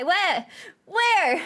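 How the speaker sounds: loud, high, eager cries; sudden high-pitched burst of excitement; excited searching. Pretend. Excited search for the cutie pie.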